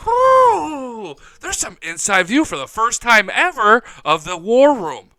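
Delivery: loud, high whooping announcement; hyped mock reveal. Host imitating the Bills revealing their war room, loud and hyped. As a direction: shout it like a triumphant big reveal.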